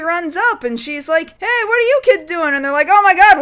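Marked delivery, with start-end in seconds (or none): 1.4-2.5 higher, fast, lightly voiced call; a hint of a big sister scolding; mock Kaori calling out. Kaori runs up to the kids on the cliff: quick, higher mock call. 2.9-3.4 higher, louder startled gasp of words; mock startled kids. Kids caught dumping the bag: louder, higher mock fright.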